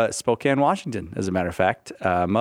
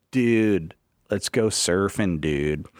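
put-on slow surfer American accent with a hard R in surfing; American surfer-dude accent, drawn out; mock American accent; 5 semitones lower, much slower than usual. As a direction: imitate an exaggerated American accent, dropping low on the last dude. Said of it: "they hit the R really hard".

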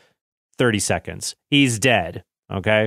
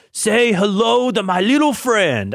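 failed Al Pacino bellow; Al Pacino impression; 9 semitones higher, slower than usual. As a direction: ham up Pacino's famous line. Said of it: "if I could do an Al Pacino impression as Han Solo".

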